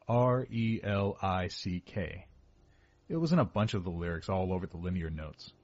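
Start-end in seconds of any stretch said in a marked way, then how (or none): 0.1-2.3 each letter spoken separately and slowly; spelled out letter by letter. Shown as a demonstration. Spelling a band name for the listener, letter by letter. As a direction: spell R E L I C K out one letter at a time. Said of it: "That's spelled".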